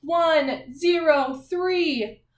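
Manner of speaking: slow, drawn-out numbers, each one stretched; announcing a room number, slow and loud; slow room number call. Shown as a demonstration. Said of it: "when I say your class's room number".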